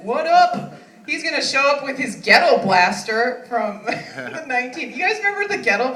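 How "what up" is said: high, louder hype-man greeting. Retold or imitated. Her brother DJ Ted greeting the crowd, hype call. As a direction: toss it out like a party DJ.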